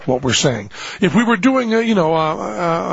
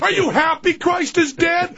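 much higher, frantic parental shouting; yelling parent; 14 semitones higher than usual. Acted. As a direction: shout the kid down in a high, strained voice. Parent scolding screaming kid.

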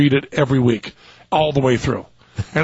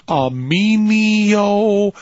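very slow sounding out; reading aloud haltingly; much slower than usual. Retold or imitated. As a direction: spell it out like a child. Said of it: "just sounding out the words like, you know, like a five-year-old".

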